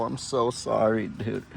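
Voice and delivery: slow and soft, lingering on so; gently sorry to his goat; tender apology. Real, not acted. Putting pet goat away for night.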